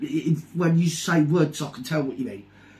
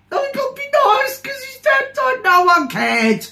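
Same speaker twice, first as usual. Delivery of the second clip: very high, loud put-on whiny voice; mocking whine; 6 dB louder than usual. Mimicking those who say be nice, mocking squeal. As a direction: squeal it in mock sympathy.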